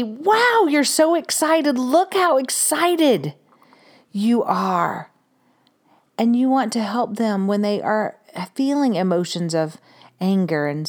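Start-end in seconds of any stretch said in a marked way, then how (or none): 0.0-5.1 high, slow and bright for a little one; excited mirroring. Shown as a demonstration. Mother matching her excited child, high and slow.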